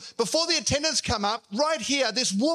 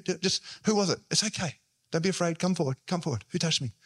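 gentle reassurance; 3 semitones lower, faster than usual. Shown as a demonstration. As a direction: invite someone forward without shaming them. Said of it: "I reckon he was saying".

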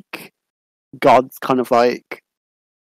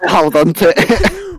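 laughing; 5 semitones higher, slower than usual. Pretend. Playacting climbing the statue.

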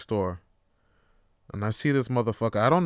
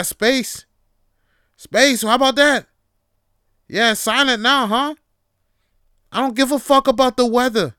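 higher, louder, ranting threats; imagined rant at an office stranger, loud and hammed-up; mock tirade; 8 semitones higher, 6 dB louder than usual. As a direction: yell a hammed-up rant at a small talker. Continuing the rant.

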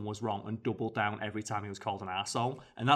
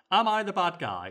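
higher, louder quoted question; mock indignant question; 7 semitones higher, 5 dB louder than usual. Quoting the aggrieved forum poster.

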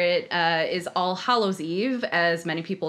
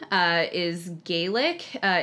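usual speaking voice

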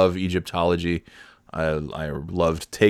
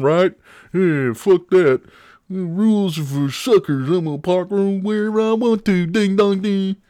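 mocking parker voice; 8 semitones higher, 3 dB louder than usual. Ranting impression of bad parker.

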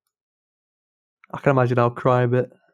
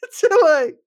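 normal talking voice

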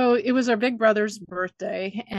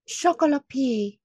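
panicked fake French, pitched up; mock French accent; 6 semitones higher than usual. Sister faking French for the family, in mock French accent. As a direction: blurt it in a bad French accent. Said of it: "I just went".

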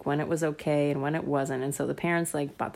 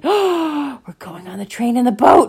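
higher, louder delighted gasp-then-reply; excited girl; 6 semitones higher, 9 dB louder than usual. Acted. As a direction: remember the trip with joy. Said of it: "And she said, gasps".

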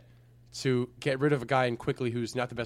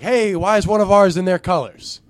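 loud, high indignant fan; Boston fan voice; 7 semitones higher, 11 dB louder than usual. Retold or imitated. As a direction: shout like an offended fan. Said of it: "they also might be like".

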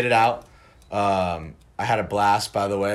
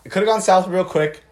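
higher, louder, wound up; excited; 9 semitones higher, 6 dB louder than usual. Real, not acted. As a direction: pump the line up. Hyped about a near fight.

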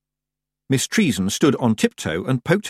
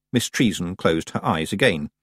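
plain reading voice

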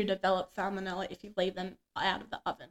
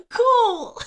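awkward cool; 11 semitones higher, 9 dB louder than usual. Retold or imitated. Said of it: "I'm like".

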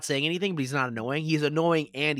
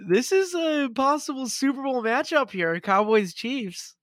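high, loud hype call; excited announcing; 11 semitones higher, 4 dB louder, slower than usual. Real, not acted. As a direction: pump up the matchup. Hyping a big matchup.

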